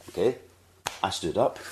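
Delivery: usual speaking voice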